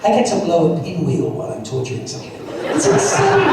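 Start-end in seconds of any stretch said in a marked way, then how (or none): 2.6-3.5 pitched way up, quick and bright with disbelief; high exclamation. Real, not acted. Actor marvelling at his lines, high and amazed. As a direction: fling it high like it's unbelievable. Repeating the line about torture.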